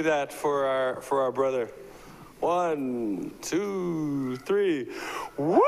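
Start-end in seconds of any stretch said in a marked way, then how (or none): 5.4-5.6 woo cheer. Real, not acted. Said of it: "I'm gonna get everyone to go, "Woo!".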